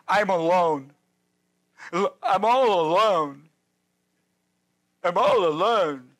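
lightly whiny, self-pitying Elijah; whining Elijah. Retold or imitated. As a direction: mope it out slowly like a sulking prophet. Preacher voices whining Elijah.

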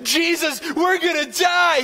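high, mock-panicked cry; frightened disciples wailing. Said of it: "They're like".

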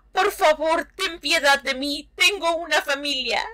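high pleading victim voice; frightened victim pleading; begging for mercy. Acted. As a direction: beg for your life, high-pitched.

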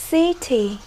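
"city" with stress on the first syllable; teacher modelling CI-ty. Shown as a demonstration. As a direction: stress the opening of "city", let the ending fall. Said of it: "Stress on the first syllable".

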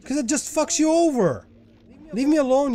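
high-pitched aggrieved complaint; exasperated protest. Real, not acted. Gamer begging a mine to back off, exasperated protest. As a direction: whine your grievance at the mine.

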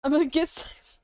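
ordinary talk, nothing marked

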